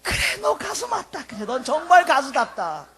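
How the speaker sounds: high, emphatic burst of conviction, easing down; imitated admiring response. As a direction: answer as a deeply moved listener. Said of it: "여러분 속에 있던 반응이 뭐야". (감동한 청중처럼 힘주어 인정하며)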